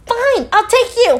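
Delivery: high, exasperated snap back; acted angry concession. Giving in to the drunk date, exasperated. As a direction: give in with acted fury, pitched right up.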